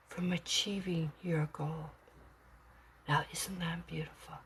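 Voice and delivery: soft whispered awe; hushed, moved whisper; tender whisper. Real, not acted. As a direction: whisper it like a gift. Tender hushed ending of reading.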